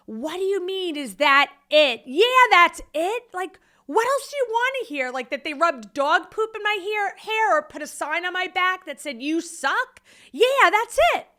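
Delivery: high, loud, incredulous retort retold; indignant yelling. Tearful wife firing back at her husband, indignant yelling.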